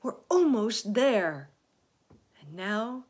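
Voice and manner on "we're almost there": loud, drawn-out cheer; big encouraging call; shouting. A narrator's reading. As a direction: shout it slowly with conviction. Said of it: "shouted the old green grasshopper".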